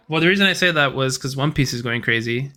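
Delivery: usual speaking voice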